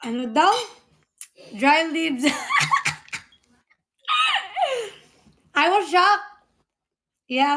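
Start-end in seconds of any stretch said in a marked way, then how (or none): 2.3-3.2 high-pitched laugh; high giggle. Real, not acted. Wife giggles recalling the dry leaves. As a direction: squeal with laughter.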